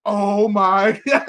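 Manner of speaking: loud, slow, drawn-out astonishment; hammed-up stunned reaction breaking into a laugh; mock shock. Acts out shocked first viewing.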